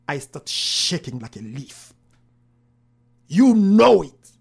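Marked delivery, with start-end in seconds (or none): 3.3-4.3 loud, slow booming command; big booming divine voice. Retold or imitated. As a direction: boom it out like God speaking. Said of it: "with the big voice".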